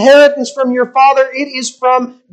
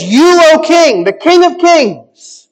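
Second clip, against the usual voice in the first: slow, louder, higher royal proclamation; grand flattery to a king; 5 semitones higher, 4 dB louder, slower than usual. Acted. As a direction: hail the king like a courtier flattering him. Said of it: "The first thing he says".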